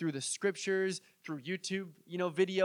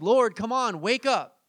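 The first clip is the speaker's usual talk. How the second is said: louder, higher mock nagging; annoying pestering; 3 semitones higher, 4 dB louder than usual. Pushy believer knocking to wake the Lord, mock demanding. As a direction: badger someone through a closed door. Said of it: "these annoying people knocking on the door".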